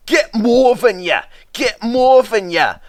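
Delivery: high, loud Scottish push; puffed. Acted. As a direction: shove the trucks from behind. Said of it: "puffed Douglas from behind".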